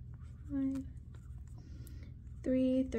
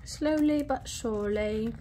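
slight slip into an English accent. Real, not acted. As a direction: lapse playfully into a light English accent. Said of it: "slip into an English accent".

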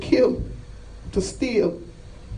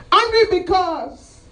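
high, slow, drawn-out shout; impassioned preaching cry; 9 semitones higher, 4 dB louder, slower than usual. Acted. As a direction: draw out the refrain in a raised cry. Preacher cries out refrain of anger.

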